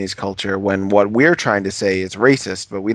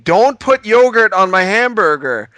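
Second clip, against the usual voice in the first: much higher, louder yell at the cook; retold yelling; 10 semitones higher, 6 dB louder than usual. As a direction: shout your order over the noise. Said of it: "I was, like, yelling at a man".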